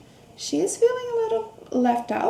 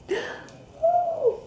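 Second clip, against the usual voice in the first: surprised gasp; 13 semitones higher than usual. Acted. Gasping at the coming splash.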